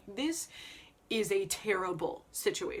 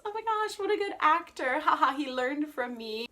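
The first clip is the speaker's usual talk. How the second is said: mock delight; 6 semitones higher, 5 dB louder than usual. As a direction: play the oblivious, proud heroine gushing. Acts Tosca admiring fake death.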